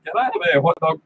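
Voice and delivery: mock Russian accent. Shown as a demonstration. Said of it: "You have to say it like Russian".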